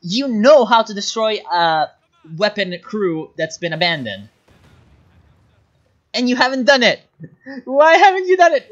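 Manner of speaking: rising to a high, loud frustrated yell; real exasperation building to a shout; angry scolding. Real, not acted. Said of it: "I'm mad at you right now".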